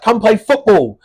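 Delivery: high, loud friendly call. Retold or imitated. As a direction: call a mate over to join in. Retold invitation from old friend.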